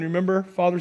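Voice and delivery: ordinary talk, nothing marked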